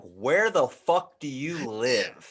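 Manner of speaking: slow, emphatic disbelief; drawn-out bafflement; incredulous retort. Retold or imitated. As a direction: stretch out the question in mock outrage. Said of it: "I was like".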